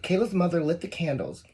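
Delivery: ordinary delivery, nothing marked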